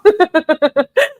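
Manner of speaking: high, loud burst of laughter; real laugh. Real, not acted. Host overwhelmed after reading the texts, high laughing fit. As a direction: crack up in a high cackle.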